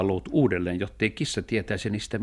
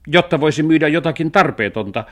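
high, loud indignation; annoyed cat; 8 semitones higher, 9 dB louder than usual. A narrator's reading. Cat argues with the dog over money, annoyed. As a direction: snap back as a cross cat. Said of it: "suuttui kissa".

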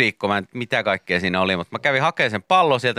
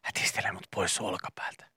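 breathy whisper of a tiny bug into the ear; whispering aphid; 3 semitones lower, 17 dB quieter than usual. Pretend. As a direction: whisper like an insect on the shoulder. (kirva kuiskaa korvaan)

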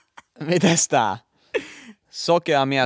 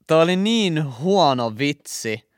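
ordinary delivery

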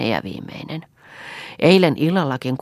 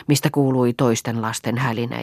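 ordinary delivery

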